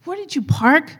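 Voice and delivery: high, challenging demand from a gruff church usher; rough usher demanding. Retold or imitated. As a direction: snap the question at them like a gatekeeper. Parking guy confronts late couple.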